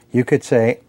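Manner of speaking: ordinary delivery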